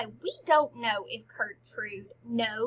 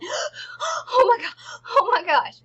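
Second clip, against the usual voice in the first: gasping, high and loud mock panic; panicked freak-out; 11 semitones higher, 9 dB louder, slower than usual. Queen runs in to the king, freaking out in mock panic. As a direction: play a breathless, hammed-up freak-out. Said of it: "she's, like, freaking out".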